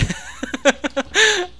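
long high falling laugh. Real, not acted. Player laughing at the wrecked fighter, high laugh. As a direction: keep laughing at the damage.

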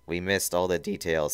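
ordinary delivery, nothing marked

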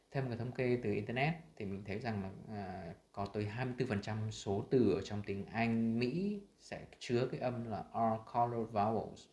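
ordinary delivery

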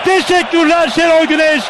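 loud grateful cheer; cheering shout. Real, not acted. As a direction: shout thanks to the coach. Cheering after goal.

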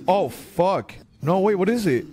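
ordinary talk

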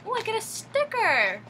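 high, loud delighted cry; excited. Real, not acted. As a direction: squeal with delight. Delighted at finding a sticker.

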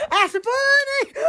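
high, slow laughing that's funny; laughing through it. Retold or imitated. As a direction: crack up while saying That's funny. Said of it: "And I was like".

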